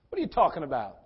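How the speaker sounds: ordinary delivery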